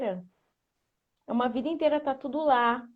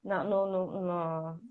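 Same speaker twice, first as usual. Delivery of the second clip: usual speaking voice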